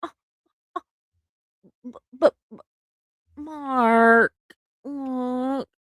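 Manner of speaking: put-on high, doting baby-talk coo; cooing at baby shoes. Cooing to her husband over baby shoes. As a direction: coo over a tiny shoe in the shop. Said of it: "I'll go".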